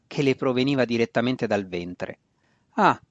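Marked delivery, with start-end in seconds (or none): none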